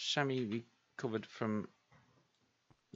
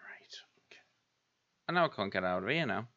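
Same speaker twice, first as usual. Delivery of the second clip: usual speaking voice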